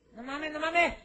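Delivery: high, loud repeated cry; panicked shout. Acted. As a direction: escalate into a panicked yell. Ant sinking, panicking.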